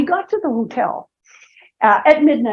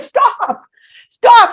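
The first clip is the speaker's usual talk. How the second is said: retold shout; 9 semitones higher, 7 dB louder than usual. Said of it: "he literally shouted at me".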